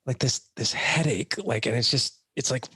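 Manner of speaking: lightly voiced wife describing her pain; wife's whiny complaint. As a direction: act out a wife's sore-headed complaint. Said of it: "she's like".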